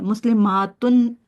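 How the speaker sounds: मुस्लिमातुन with the aa stretched long and slow; slow plural मुस्लिमातुन; elongated plural. Shown as a demonstration. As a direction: draw out the aa in मुस्लिमातुन. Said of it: "elongate करती हैं".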